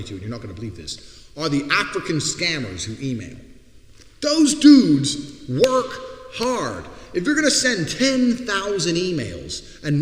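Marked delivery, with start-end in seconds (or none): 4.2-5.9 much higher, louder, very slow; drawn-out emphasis. Real, not acted. Praising email scammers, slow loud emphasis. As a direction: stretch "work" out as a big exclamation.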